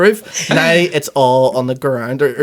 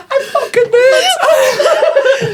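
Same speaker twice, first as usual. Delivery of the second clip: very high squeal, words stretched by laughing; helpless real laughter; 3 dB louder, much slower than usual. Real, not acted. Storyteller who knew it was W five, helpless with laughter. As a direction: barely get the words out for laughing. Breaks up at own punchline.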